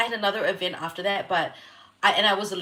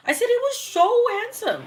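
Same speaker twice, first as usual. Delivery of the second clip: giddy; 14 semitones higher, 3 dB louder than usual. Real, not acted. Laughing at her own flirting.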